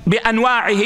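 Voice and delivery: usual speaking voice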